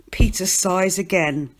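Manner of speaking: ordinary delivery, nothing marked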